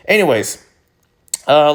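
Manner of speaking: ordinary talk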